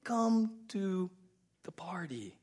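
high, slow coaxing invitation; father inviting son to party; pleading father. Retold or imitated. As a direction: coax him inside. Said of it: "the father's like".

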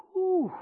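sharp high whoosh of breath; loud high whew. Real, not acted. Pastor blows out a loud high whew after the hard verse.

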